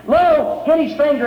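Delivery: ordinary talk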